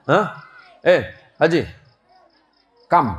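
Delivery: a lightly voiced foreign trader, laughing; a half-laughed confused merchant.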